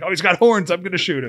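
loud, high burst of excitement; retold excited outburst; fired up. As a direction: relive the hunter's thrill at full volume. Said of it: "I was all fired up too".

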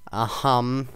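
usual speaking voice

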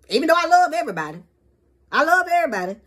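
loud, high protest of love; emphatic. Real, not acted. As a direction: insist you love everybody, pushing up.

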